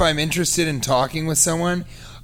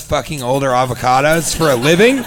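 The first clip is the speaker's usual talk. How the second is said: ordinary talk, nothing marked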